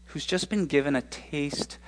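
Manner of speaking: ordinary delivery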